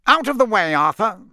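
loud, curt order; cold, curt. A narrator's reading. Crouch closing in on the children, cold curt order. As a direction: command a colleague aside coldly. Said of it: "said a cold, curt voice".

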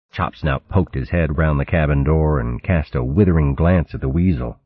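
plain reading voice

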